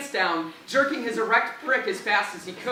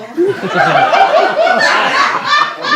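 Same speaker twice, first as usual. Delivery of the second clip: real, high, loud burst of laughter; 13 dB louder than usual. Real, not acted. Narrator cracks up at the reading, loud laugh. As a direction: break up laughing.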